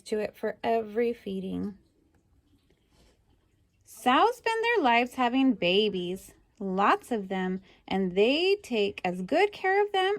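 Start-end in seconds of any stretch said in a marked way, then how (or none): none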